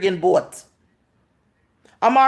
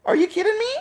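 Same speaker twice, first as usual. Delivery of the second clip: incredulous; 10 semitones higher, faster than usual. Real, not acted. Incredulous high retort.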